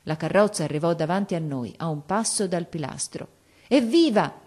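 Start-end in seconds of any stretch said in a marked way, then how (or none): 3.7-4.3 high, loud hurrah; shouted cheer. A narrator's reading. Crowd hailing the king's carriage, shouted cheer. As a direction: cheer it out with raised voice. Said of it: "gridarono molte voci".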